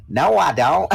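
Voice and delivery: high, loud mock Texan twang; Texas accent. Kid denying to mom the Texas sound, in Texas accent. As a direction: do the kid's exaggerated Texas accent. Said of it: "sound like you're from Texas".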